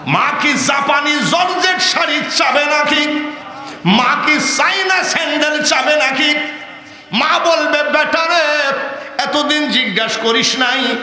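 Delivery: high, shouted rhetorical questioning; full-force sermon cry; impassioned preaching. Acted. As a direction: fire it at the crowd high and forceful.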